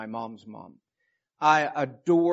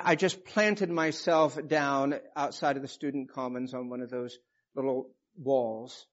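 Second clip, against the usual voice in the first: ordinary talk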